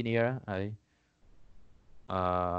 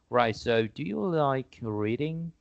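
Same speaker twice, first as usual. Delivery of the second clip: slow, slightly higher English read-out; measured model question for learners; examiner reading question; 4 semitones higher, much slower than usual. Acted. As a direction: pose the question slowly and deliberately. Reads the examiner's sample question.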